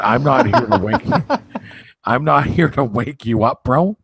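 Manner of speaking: high, laughing through the words; can't keep a straight face. Retold or imitated. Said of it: "I go".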